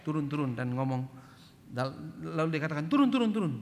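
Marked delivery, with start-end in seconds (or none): 2.9-3.5 loud, raised-pitch command to get out; imitated barked order. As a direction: order the driver out urgently. Gang orders him out of car. (meniru bentakan menyuruh turun)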